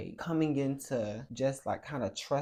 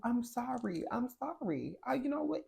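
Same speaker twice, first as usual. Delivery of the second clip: high, frantic sorry to a spirit; a high, panicked plea for forgiveness; frantic apology; 11 semitones higher than usual. Retold or imitated. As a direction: plead in a high, scared voice.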